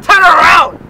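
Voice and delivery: loud, high corrected shout. Retold or imitated. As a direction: yell it, just the two words. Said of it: "I didn't say that. I said".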